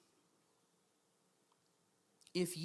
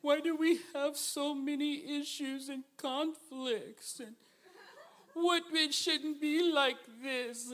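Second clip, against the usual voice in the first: whiny complaint; 6 semitones higher, slower than usual. Retold or imitated. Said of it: "the enemy's messing with some people 'cause they're saying".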